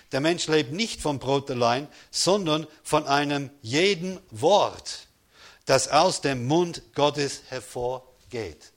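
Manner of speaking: slow, weighted reading with stress on jeden; emphatic scripture quote. Acted. Preacher reading the verse to the congregation, slow and weighted. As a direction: lean into each word of the verse.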